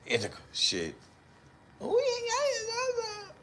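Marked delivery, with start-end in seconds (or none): 1.8-3.3 very high squeaky babble; imitated baby babbling.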